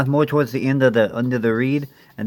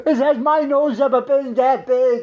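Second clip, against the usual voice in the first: high, slow desperate plea; husband pleading with wife Peg, pitched way up; 11 semitones higher, much slower than usual. Acted. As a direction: beg your wife to see the difference.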